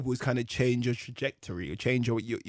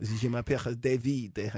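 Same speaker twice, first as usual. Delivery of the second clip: put-on French accent; 4 dB quieter than usual. Imitating French date.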